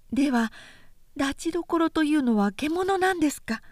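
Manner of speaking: a sister puzzled at her brother's beard; Ichijo, the younger sister. A narrator's reading. Sister asks again.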